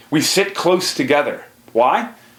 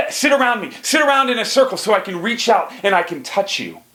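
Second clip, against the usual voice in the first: commanding tribe leader; 8 semitones higher, faster than usual. Acted. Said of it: "The leader says".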